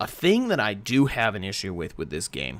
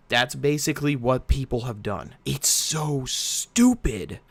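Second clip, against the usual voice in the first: normal talking voice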